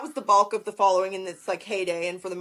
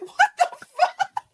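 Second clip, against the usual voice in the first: very high, drawn-out laughing squeal; cracking up in high-pitched astonishment; laughing disbelief; slower than usual. Acted. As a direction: stretch it out in mock disbelief, laughing. Acted reaction to commenters, laughing.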